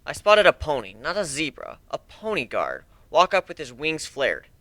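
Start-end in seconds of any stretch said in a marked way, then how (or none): none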